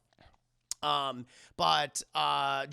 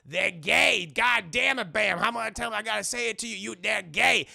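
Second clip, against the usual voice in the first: loud, high exasperated scolding; exasperated yell; 4 semitones higher, 7 dB louder than usual. Retold or imitated. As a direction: throw up your hands and yell it. Madea snapping at Bam.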